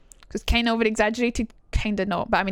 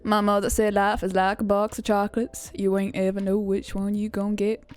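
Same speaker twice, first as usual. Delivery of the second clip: American southern accent impression; Forrest Gump. Streamer showing famous American accents, southern film-character impression. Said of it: "So you've got Forrest Gump".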